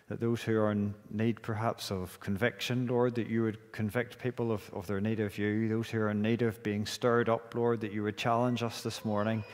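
subdued, even-toned spoken prayer. Real, not acted. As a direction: lead a congregation in extemporaneous prayer. Leads opening prayer.

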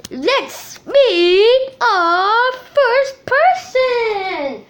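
ordinary talk, nothing marked

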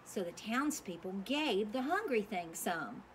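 ordinary delivery, nothing marked

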